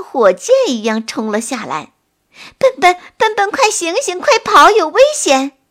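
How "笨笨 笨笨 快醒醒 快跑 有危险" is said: high, loud alarmed calling; urgent warning shout. Acted. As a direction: yell a warning to wake him. Friends warn of the snake.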